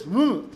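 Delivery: loud, short reluctant hmm; a gruff, unwilling hum; grudging grunt. Retold or imitated. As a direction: grunt out a half-please. Imitating a grudging grunt of respect.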